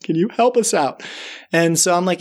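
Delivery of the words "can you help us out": high-pitched little mouse voices, apologetic; pleading mice. Retold or imitated. Mice begging Joe to save them, high pleading. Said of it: "So they're like".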